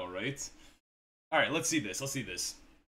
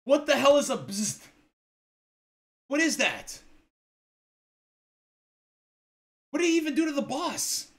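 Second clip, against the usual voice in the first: baffled; 8 semitones higher, 6 dB louder than usual. Real, not acted. Baffled by the boss kill.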